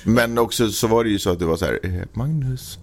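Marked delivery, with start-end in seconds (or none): 2.1-2.8 soft, higher mock-gentle call; imitating kindest voice. Co-host imitates a partner's wake-up call, sweetly. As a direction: call the name softly, as if very kindly. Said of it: "med min snällaste röst".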